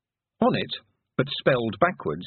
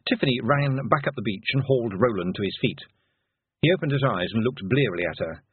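usual speaking voice

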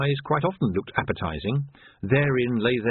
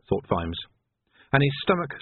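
usual speaking voice

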